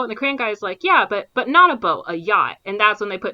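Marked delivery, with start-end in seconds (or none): 0.8-2.6 loud stress on not a boat; emphatic correction. Retold or imitated. Informant corrects detectives, loud stress on yacht.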